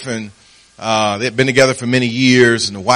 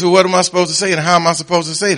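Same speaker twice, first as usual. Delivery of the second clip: fast, high, exasperated protest; defensive husband; 7 semitones higher, much faster than usual. Retold or imitated. Husband pushes back at his wife, fast exasperated protest. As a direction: rattle it off, baffled and defensive.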